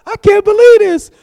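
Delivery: a hammed-up stunned pastor; imitating shock. Pastor seeing crowd camped out early, hammed-up shock.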